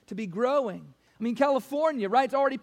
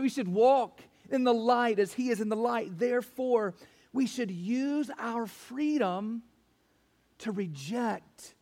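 ordinary talk, nothing marked

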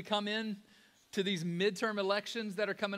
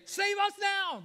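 high, loud cry for help; desperate plea; 11 semitones higher, 5 dB louder than usual. Retold or imitated. Preacher acting a desperate plea for rescue. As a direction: call out as someone in trouble. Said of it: "we would say".

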